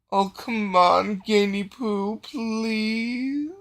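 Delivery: high, drawn-out mock begging; hammed-up coaxing with a pet name; wheedling plea. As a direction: stretch the last word into a long pleading whine. Game master voices Delgar begging Gain.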